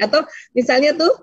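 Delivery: ordinary talk, nothing marked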